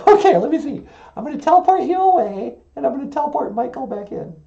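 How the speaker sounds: high puppet voice, quick patter; high, brisk puppet character. Acted. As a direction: play a high-voiced puppet working a teleporter.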